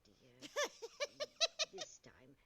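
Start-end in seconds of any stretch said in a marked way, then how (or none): 0.5-1.7 short burst of high laughter. Real, not acted. Breaking into laughter mid-scene. Laugh after calling her dear.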